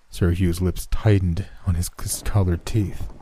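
plain reading voice

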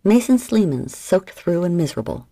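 ordinary delivery, nothing marked

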